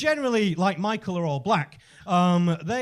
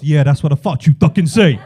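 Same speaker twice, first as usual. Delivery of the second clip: low, loud cocky put-down; gloating tough rat; 6 semitones lower, 13 dB louder than usual. Acted. Rat to the retreating cat, loud low gloating. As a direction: drop the pitch and crow over the loser.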